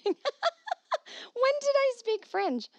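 high, drawn-out laughing question; laughing squeal of disbelief; incredulous laughing. Real, not acted. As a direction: crack up while asking in disbelief. Laughs at listener's compliment.

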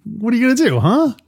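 high-pitched challenge, imitating the cop; challenging huh. Cop questioning someone meddling in his business, challenging. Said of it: "sounds more like, "Huh?".

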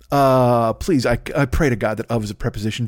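normal talking voice

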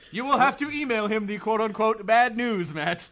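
high, loud, slow proclamation; mock grave announcement. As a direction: declare it with exaggerated formality. Mock answer to the emailer's plea.

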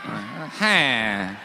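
low, deflated laugh syllable; a mock-sad 'ha', pitched well down. Shown as a demonstration. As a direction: demonstrate a joyless little laugh. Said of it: "This is more of a sad ha".

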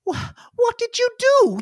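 put-on high, fussing old lady voice. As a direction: scold like a flustered granny. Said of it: "she goes".

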